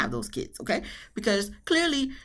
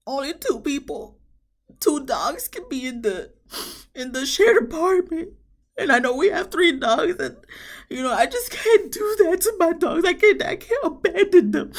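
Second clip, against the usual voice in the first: high, louder whiny wail; mock crying Chris; 9 semitones higher, 3 dB louder than usual. Imitating Chris sobbing over his dogs, high whiny wail. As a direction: ham up a sobbing complaint.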